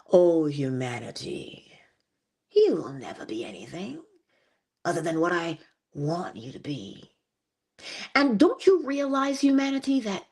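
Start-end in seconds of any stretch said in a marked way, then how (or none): 0.1-7.1 sinister whisperer. Acted. Something age-old whispering to humanity, low insinuating voice. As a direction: whisper it down at them, low and controlling. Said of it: "it whispers to humanity in a very particular way".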